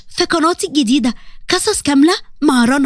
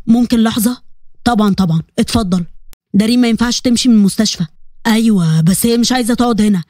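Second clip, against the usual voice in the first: lower, firm male speakers; men's voices; 5 semitones lower than usual. Acted. Brother, partner and doctor talking at the hospital, lower and firm. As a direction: speak down in a man's register.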